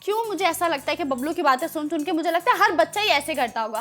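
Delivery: ordinary talk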